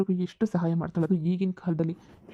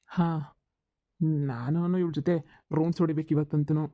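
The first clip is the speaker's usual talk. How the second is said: normal talking voice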